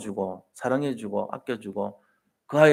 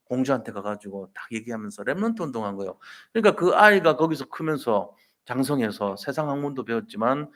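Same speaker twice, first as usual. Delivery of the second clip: usual speaking voice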